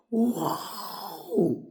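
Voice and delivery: long, high, drawn-out wow; imitated awe. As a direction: stretch it out in mock wonder. Friends see inside the closet.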